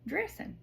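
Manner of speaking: "dressing" as "dressin'", high and playful; dropped G. Shown as a demonstration. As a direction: say "dressing" ending on a plain N, no G. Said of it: "with no G".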